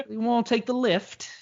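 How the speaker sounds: high-pitched put-on Cockney; Cockney accent. Shown as a demonstration. Podcaster showing off his accent, mock Cockney. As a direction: improvise a line in mock Cockney. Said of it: "the old Cockney accent".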